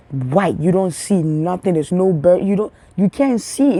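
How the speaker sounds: normal talking voice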